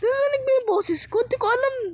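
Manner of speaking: high, squeaky worried question; suddenly high, unsure if anyone heard; high-pitched fretting. Real, not acted.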